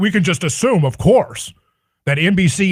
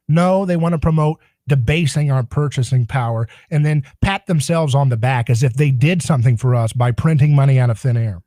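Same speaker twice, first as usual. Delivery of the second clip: ordinary delivery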